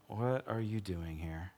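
soft, slow and low; the still small voice asking softly; gentle whisper. A narrator's reading. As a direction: ask it gently, barely above a breath. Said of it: "a gentle whisper".

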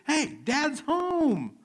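put-on very high, loud, drawn-out kid yell; imitated child's scream. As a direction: scream it slowly like a child calling the siblings. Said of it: "she will scream to the announcer".

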